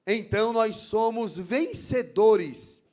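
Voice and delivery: loud, emphatic sermon delivery building in force; forceful preaching. Real, not acted. Preacher warning the church about the enemy of God, forceful preaching. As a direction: preach it out loud to the congregation, driving each point home.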